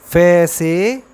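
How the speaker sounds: very slow, each sound stretched; drawn-out letter name. Shown as a demonstration. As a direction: spell it out slowly for the class. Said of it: "अब आगे आता है फे". (धीमे, खींचकर बोलना)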